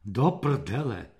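low, slow grumbled curse; angry growl. A narrator's reading. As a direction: curse heavily under your anger. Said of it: "zahučel zlostně". (zlostné zahučení)

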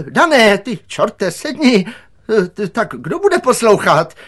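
high, loud scolding; exasperated commands. A narrator's reading. Director orders Dan to sit and obey, exasperated. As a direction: assert authority in a panicky shout.

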